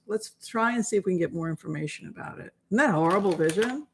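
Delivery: ordinary delivery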